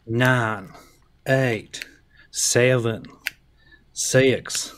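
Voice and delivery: drawn-out southern drawl countdown; southern accent. Pretend. Co-host counting down the deletion, mock southern accent. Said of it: "It's my southern accent".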